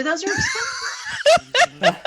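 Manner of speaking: high laughing protest; indignant and cracking up. Real, not acted.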